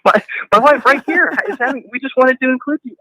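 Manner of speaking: high, eager acted voice; high eager friend voice; awkward announcement. Acted awkward exchange continues.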